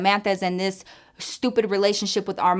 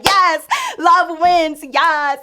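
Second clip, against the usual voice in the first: slow, loud, much higher cheer; 9 semitones higher, 7 dB louder, much slower than usual. Retold or imitated. Cheering the couple across the street, loud and slow. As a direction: drag it out like a victory cheer.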